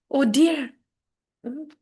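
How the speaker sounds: normal talking voice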